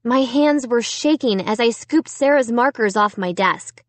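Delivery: usual speaking voice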